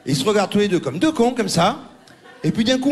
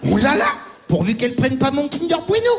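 mock panicked Joey; 9 semitones higher than usual. Comic mimicking the tennis star panicking about the neighbour, high. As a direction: squeal with fake alarm over the snack. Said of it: "il fait comme ça".

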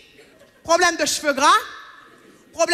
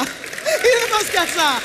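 even slower, higher wail; slower mock lament; 7 semitones higher, much slower than usual. Mocking men to the audience, drawn-out wail. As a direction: drag every word in mock despair. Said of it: "repeated punchline, drawn out".

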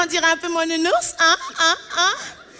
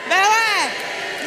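shout; 4 semitones higher than usual. Real, not acted. Shouting to the audience. (cri joyeux au public)

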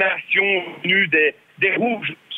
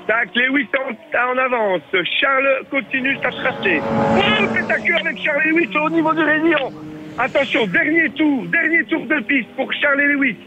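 live race commentary; 5 semitones higher than usual. Acted. Live mini-circuit race commentary.

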